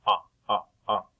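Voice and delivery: ah repeated as open short vowel; short o sound. Shown as a demonstration. Teacher sounding out top for children, short ah. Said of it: "Is it short O".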